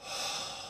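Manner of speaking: long soft audible exhale; demonstrated breath. Shown as a demonstration. Preacher showing listeners how to breathe, soft long exhale. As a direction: breathe out slowly and quietly.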